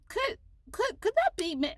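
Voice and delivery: high, breathless hopeful wondering; hopeful disbelief. Retold or imitated. A fan wondering if the famous author is her. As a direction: squeak it out, half daring to hope. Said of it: "And I'm like".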